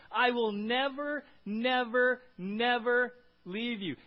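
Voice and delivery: each "never" loud, high and drawn out; slow, loud weight on "never, never, never"; emphatic triple never. Shown as a demonstration. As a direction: hammer every "never" slowly and separately. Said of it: "That's the emphasis in the original language".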